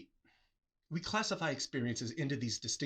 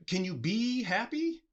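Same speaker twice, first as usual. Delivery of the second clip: usual speaking voice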